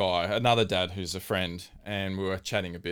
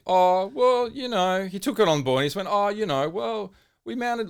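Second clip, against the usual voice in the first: polite rival fan; 10 semitones higher, 3 dB louder than usual. Retold or imitated. Rival supporter answering the ribbing, lightly voiced higher and polite. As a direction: lightly voice a fan who takes it on board.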